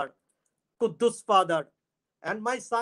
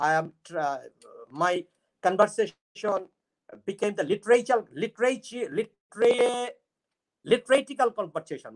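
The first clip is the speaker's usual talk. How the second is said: ordinary delivery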